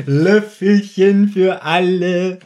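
slow, drawn-out coddling voice; spoon-feeding like a fussing parent; mock doting Bob. Mocking Bob's cutesy line to the detectives, drawn out slow. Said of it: "vor allem als Bob dann sagt".

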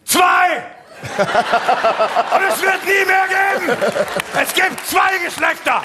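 mock ranting shout. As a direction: yell it like a ranting zealot. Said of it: "Aber warum in diesem Ton?". (gespieltes wütendes Brüllen)

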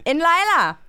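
loud, slow, high Berliner [name]; hammed-up Berlin dialect. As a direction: ham up Natürlich in thick Berliner. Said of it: "dann mach ich Berlinerisch".

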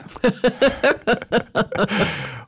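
long real laugh after the punchline. Real, not acted. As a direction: burst of real laughter, high. Laughing at his own story.

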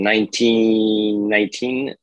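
usual speaking voice

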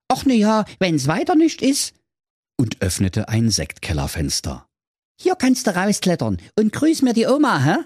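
high casual knight; high Manni voice. Acted. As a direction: toss it off like a cheeky boy.

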